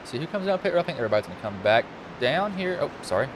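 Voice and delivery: ordinary delivery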